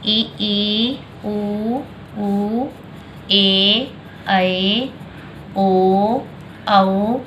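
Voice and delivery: each Hindi vowel drawn out on its own; vowel recital. Shown as a demonstration. Teacher reciting vowels to class, slow and clear. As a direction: recite ई, उ, ऊ, ए, ऐ, ओ, औ slowly one by one. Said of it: "ये हमारे स्वर हैं".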